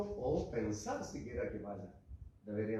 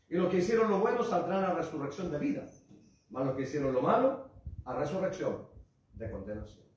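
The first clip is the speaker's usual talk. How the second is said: fervent preaching; 4 semitones higher, 6 dB louder than usual. Acted. Preacher quoting scripture forcefully.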